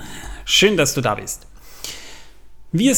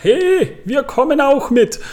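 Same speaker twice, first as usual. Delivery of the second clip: much higher, louder cheeky hobbit; eager hobbit voice; 12 semitones higher, 5 dB louder, slower than usual. Retold or imitated. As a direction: pipe up like an eager young hobbit. Merry's line, voiced in retelling.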